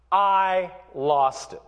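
ordinary talk

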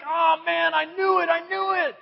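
high, loud thrilled father; excited dad. Acted. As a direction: gush like a dad who just got lucky. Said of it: "Jesse's like".

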